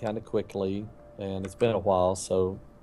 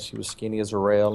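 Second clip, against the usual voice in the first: usual speaking voice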